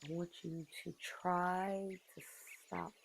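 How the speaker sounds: very soft, unhurried meditation voice; guided meditation. Real, not acted. Asking listeners to close their eyes and go inward, guided meditation. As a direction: ease them inward softly, with long pauses between phrases.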